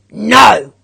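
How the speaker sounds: loud gruff refusal; growled no. A narrator's reading. Grumpy neighbour refusing the girl's request, loud growl. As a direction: bark it to send her away. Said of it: "growled Mister Wintergarten".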